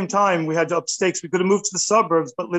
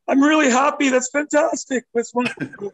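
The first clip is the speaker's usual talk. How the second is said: retelling his reaction to twins, mock thrilled and higher; mock overjoyed; 8 semitones higher than usual. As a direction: burst out in hammed-up delight. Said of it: "I'm like".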